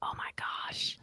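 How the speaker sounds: hushed excited whisper. Acted. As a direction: breathe it in a whisper. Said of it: "Why are we whispering?".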